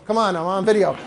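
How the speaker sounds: ordinary talk, nothing marked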